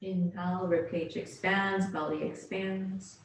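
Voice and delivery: calm, measured yoga-teacher voice; unhurried relaxation-class delivery; guided yoga meditation. Real, not acted. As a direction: guide them gently into their breath, leaving space between phrases. Leads yoga breathing meditation.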